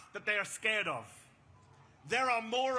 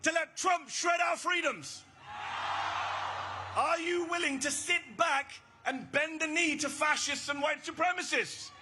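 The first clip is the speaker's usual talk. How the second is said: rally shout; 5 semitones higher than usual. Real, not acted. Rally speaker shouting a challenge at the crowd. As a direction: shout the question out over the crowd.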